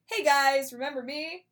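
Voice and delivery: loud, bright mock-cheery hey guys; cheeky greeting. Queen Margaret crashing the party, loud cheeky hello. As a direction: barge in with a sassy hello.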